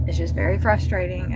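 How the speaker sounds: low, soft, worn-out sigh of frustration; quiet and fed up, real; frustrated sigh. Real, not acted. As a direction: sigh it out, defeated. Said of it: "as you can tell".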